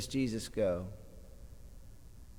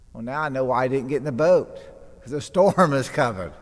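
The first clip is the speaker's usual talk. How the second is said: loud, fast, rueful grumble; mock-indignant realisation; wry complaint; 3 semitones higher, 9 dB louder, faster than usual. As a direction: blurt it out like you've just caught on. Disciple grumbling in the boat.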